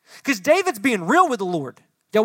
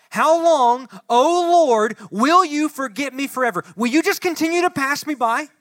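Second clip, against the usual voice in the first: loud, high pleading cry to God; raised, pleading outcry; anguished cry; 6 semitones higher, 5 dB louder than usual. Acted. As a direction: cry it out like a desperate king. Preacher voicing David's cry.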